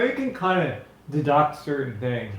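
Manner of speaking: ordinary delivery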